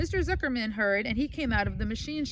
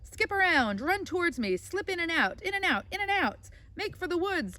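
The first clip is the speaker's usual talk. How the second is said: high, urgent goose commands; lightly voiced goose taking command; crying out orders; 7 semitones higher than usual. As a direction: drive the pig on like a coach. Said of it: "cried the goose".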